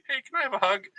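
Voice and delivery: high, light mock plea for a hug; high hopeful mock request; hopeful ask. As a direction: ask sweetly like an awkward middle-aged dude. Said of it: "if I go up to somebody".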